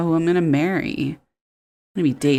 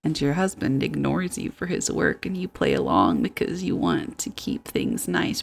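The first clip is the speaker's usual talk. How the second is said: ordinary talk, nothing marked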